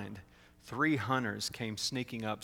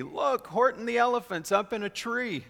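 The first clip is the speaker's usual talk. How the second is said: high-pitched jeering glee; excited, high taunting call; gleeful shout; 10 semitones higher, 4 dB louder than usual. A narrator's reading. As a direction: call it out high, mocking the elephant. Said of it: "they shouted with glee".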